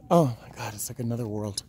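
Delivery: usual speaking voice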